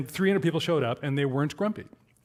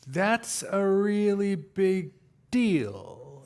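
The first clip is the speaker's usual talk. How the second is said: slow, drawn-out puzzled voice, lightly put on; imitating a slow audience guy; 5 semitones higher, much slower than usual. Man in the audience slowly puzzles over prototyping.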